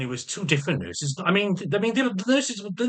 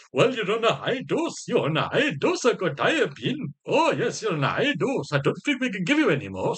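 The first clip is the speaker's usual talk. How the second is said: higher, sing-song mock doctor voice; imitating the psychiatrist; 4 semitones higher than usual. Man mocking his psychiatrist about his dose, higher and sing-song. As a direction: parody the doctor's patronising tone. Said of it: "as he said".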